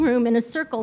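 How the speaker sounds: usual speaking voice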